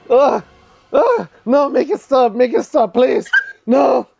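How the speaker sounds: high, gagging goblin begging; pleading goblin. Acted. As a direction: beg it to stop like a tormented goblin. Goblin begs it to stop.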